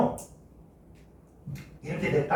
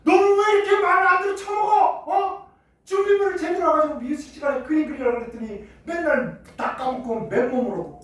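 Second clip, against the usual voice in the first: high, loud scolding rant; loud furious teacher, easing at the end; angry teacher scolding; 11 semitones higher, 7 dB louder than usual. Retold or imitated. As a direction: tear into a forgetful pupil. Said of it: "선생님 막 화를 내".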